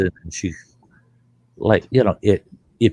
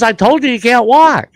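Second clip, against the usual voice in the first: wife scolding; 12 semitones higher, 6 dB louder than usual. Retold or imitated. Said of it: "She says".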